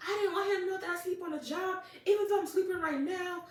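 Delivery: high, frantic anime-style protest; mock overreaction. Retelling the anime boy caught asleep, mock overreaction. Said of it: "He overreacts and he's like".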